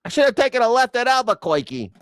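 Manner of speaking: high, nasal-free Flatbush cartoon rabbit voice; Bugs Bunny. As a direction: do the cartoon rabbit's Brooklyn line. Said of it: "Bugs Bunny used to always say".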